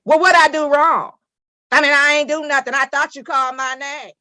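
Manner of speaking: loud, high-pitched indignant back-talk; mock smart-mouthed retort. Judge mimics defendant's wrong way of talking, smart-mouthed. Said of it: "You don't talk to me and say".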